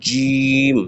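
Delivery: জিম with a buzzing, rattling sound; vibrating জিম; Arabic jeem demo. Shown as a demonstration. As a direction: buzz the জিম out. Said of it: "একটু কিটিমিটি করে আওয়াজ করে".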